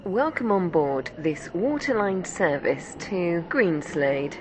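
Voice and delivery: ordinary talk, nothing marked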